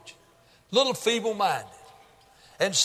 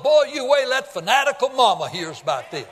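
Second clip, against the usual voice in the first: laughing through the words, louder; gleeful laugh; 4 semitones higher, 4 dB louder than usual. Retold or imitated. James imagining telling his mother, laughing gleefully. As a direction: chuckle with delight at the coming surprise.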